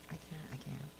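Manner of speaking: usual speaking voice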